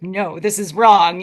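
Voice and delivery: loud, drawn-out mock scolding; mock stern correction.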